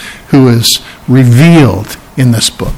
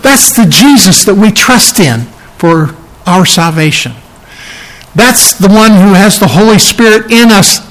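normal talking voice

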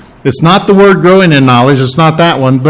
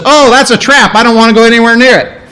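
higher-pitched voice for an animal spotting a trap; wary animal; 7 semitones higher, faster than usual. Acted. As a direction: play the animal backing off, pitched up. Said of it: "Sees a trap and says".

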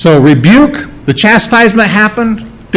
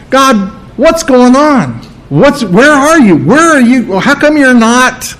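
mock panicked prayer; 7 semitones higher than usual. A believer in trouble calling out to God, higher and pleading. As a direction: play the frightened believer who feels abandoned. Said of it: "the first thing we say when we get in trouble is".

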